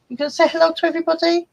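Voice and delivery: fast, high coaxing voice; pet talk. Real, not acted. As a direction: coax the cat to greet everyone. Baby talk to the cat.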